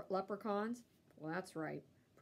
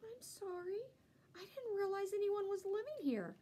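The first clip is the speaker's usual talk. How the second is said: soft apology; 11 semitones higher than usual. A narrator's reading. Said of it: "the dog said".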